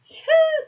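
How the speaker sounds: loud, high laugh; real laughing burst; burst of laughter. Real, not acted. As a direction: crack up at yourself. Laughs at herself.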